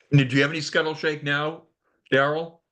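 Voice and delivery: usual speaking voice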